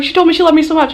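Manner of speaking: high, loud excited gush; imitating an overjoyed fangirl. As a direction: re-enact telling a friend the thrilling news. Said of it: "fangirling together".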